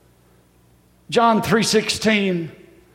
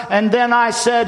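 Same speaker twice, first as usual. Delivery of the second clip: usual speaking voice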